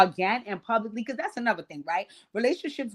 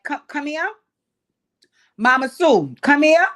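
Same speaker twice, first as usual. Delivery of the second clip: mock father calling out; 7 semitones higher, 9 dB louder than usual. Father calls Mama Sue over.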